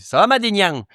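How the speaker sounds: high, hammed-up fake southern French accent; mock a southern twang with a big falling swoop; caricatured Midi accent, pitched high. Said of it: "en imitant ce qu'ils pensent être ton accent".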